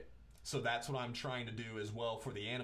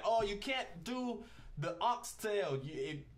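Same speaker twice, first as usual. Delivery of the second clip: put-on amateur Jamaican accent, opening high; playful, not very good Jamaican accent; attempted Jamaican accent; 4 semitones higher than usual. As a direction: mimic Jamaican diners with a rough accent. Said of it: "I'm gonna do my best to do a Jamaican accent".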